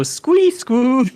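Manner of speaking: high, loud honking squeak; imitating the broken squeak; mock squee-squoo.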